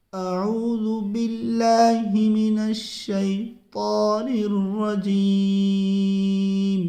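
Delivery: slow recitation with heavy full-mouth ط in الشیطان. Shown as a demonstration. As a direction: draw it out, filling the mouth on ط. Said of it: "پڑھ لیجئے آپ ہمارے ساتھ میں".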